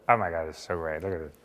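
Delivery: soft, low fanboy impression; imitated awestruck fan, hushed; Kevin Eastman. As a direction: gush under the voice. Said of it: "I hear Kevin go".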